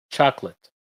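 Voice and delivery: chocolate said CHAK-let, two syllables. Shown as a demonstration. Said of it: "Casi siempre lo escuchamos con dos sílabas".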